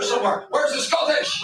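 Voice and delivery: ordinary talk, nothing marked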